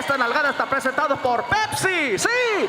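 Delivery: DJ patter climbing to high cries; radio host voice. Acted. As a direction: announce bedroom business like a radio ad. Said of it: "goes home talking like that".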